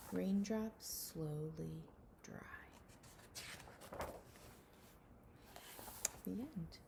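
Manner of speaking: slow, hushed storybook reading with whispered lines; reading a picture book to children. Acted. Teacher reading a rain book to her class, hushed storybook voice. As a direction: read it gently to little ones, letting some lines fall to a whisper.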